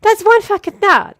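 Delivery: loud, high-pitched insistence; emphatic retold yell. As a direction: yell it back as a confirmation. Acted insistent reply in anecdote.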